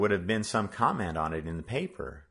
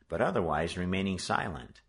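usual speaking voice

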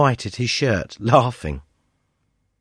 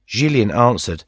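plain reading voice

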